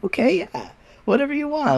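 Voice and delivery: high, mock-soothing friends' voice; friends humoring her. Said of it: "And they were like".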